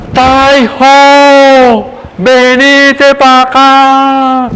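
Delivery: loud call stretched out slowly; high, loud, slow hail to a friend; shouting across. Retold or imitated. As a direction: yell the name from far away, drawn out. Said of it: "Él le grita".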